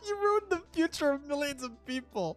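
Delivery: high, loud, cracking up mid-line; laughing while reading. Real, not acted. Streamer reading the DM aloud, laughing through it. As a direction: read it through giggles.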